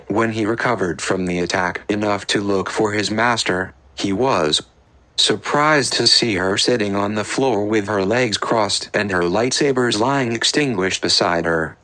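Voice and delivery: ordinary delivery, nothing marked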